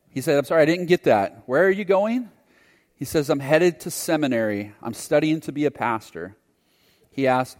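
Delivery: ordinary talk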